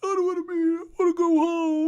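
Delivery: put-on high, loud whiny kid's wail; mock crying child. Recalling his first day at kindergarten, a mock child's crying wail. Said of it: "I'm up there crying like a".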